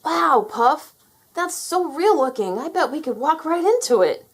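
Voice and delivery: put-on a high, loud, wide-eyed child voice; a little boy marvelling at a painting; excited young boy. A narrator's reading. Terry gasps at the painting to Puff, in a high excited boy voice.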